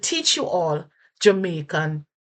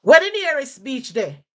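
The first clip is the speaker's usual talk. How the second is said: Jamaican patois; 7 semitones higher, 5 dB louder than usual. Shown as a demonstration. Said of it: "someone say".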